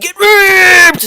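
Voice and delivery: sudden horrified shout; alarmed shout. Real, not acted. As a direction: blurt the warning out in alarm. Dramatic warning at the story's end.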